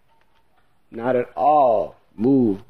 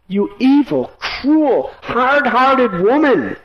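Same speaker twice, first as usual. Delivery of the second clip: king's pained outburst, much higher; anguished rebuke; 11 semitones higher than usual. A narrator's reading. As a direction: hurl the accusation, voice straining high. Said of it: "He spoke".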